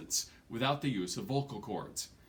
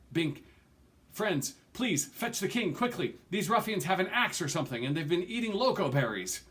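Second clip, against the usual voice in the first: urgent plea; 6 semitones higher, 4 dB louder than usual. A narrator's reading. Tree urgently pleads for help.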